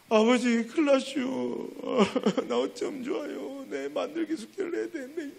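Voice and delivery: put-on boy whimpering to his father about hardened clay; crying child. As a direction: whine it like a crying little boy. Boy pleads to pastor father.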